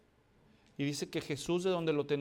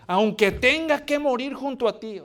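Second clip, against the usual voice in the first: loud vow; 7 semitones higher, 9 dB louder than usual. Acted. Peter vows again.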